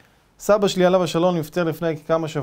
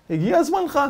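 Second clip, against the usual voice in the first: gloating; 11 semitones higher than usual. Retold or imitated. Chief's gloating verdict.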